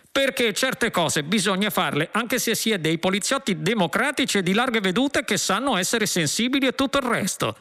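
high-pitched earnest justification; self-righteous policeman. Acted. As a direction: justify yourself as an indignant, high-voiced cop. Policeman justifies violence to Trillian.

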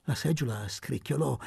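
ordinary delivery

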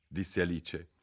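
plain reading voice

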